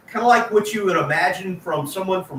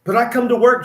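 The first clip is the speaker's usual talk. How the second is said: ordinary talk, nothing marked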